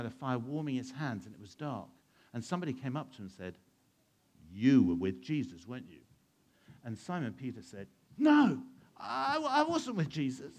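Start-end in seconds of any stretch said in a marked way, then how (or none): 4.5-6.0 pointed accusation. Acted. Stranger by the fire confronting Simon Peter, accusing. 8.2-10.5 high, loud, defensive denial; emphatic denial. Acted. Simon Peter denying Jesus by the fire, defensive.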